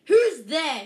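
loud, high alarmed shout. A narrator's reading. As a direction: bellow it at the door. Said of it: "he shouted".

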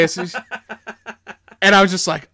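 usual speaking voice

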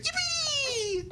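high squeaky creature squeal; yippee meme squeal. Retold or imitated. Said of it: "I love that meme".

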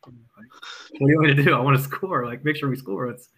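loud, exasperated retort to grumbling players; exasperated protest. Retold or imitated. Coach loudly protesting to offense about scoring. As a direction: push back loudly, fed up.

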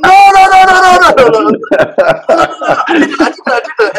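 high, loud, laughing no no no; high-pitched, flustered and laughing; laughing denial. Retold or imitated. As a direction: protest in a squeaky, giggling rush. Denying the women's praise, laughing.

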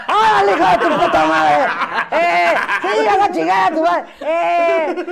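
put-on shouted drunk insults, laughing; drunk phone shouting. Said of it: "Estás marcando por teléfono a alguien".

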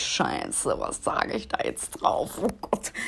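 low whispered inner panic; mock panicked thought. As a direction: mutter his panicked inner voice, slowly. Voices partner's panicked thoughts.

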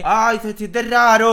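high, loud, slow put-on whine; mock fussy voice. Joking over the show's plot, high mock whine. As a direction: camp it up as a fussy character. Mocking voice for the show character.

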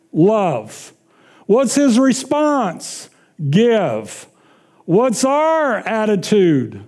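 slow questions, short falling answers; sermon call and response. Acted. Preacher quizzing the congregation, slow and deliberate. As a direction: ask slowly, answer in one word.

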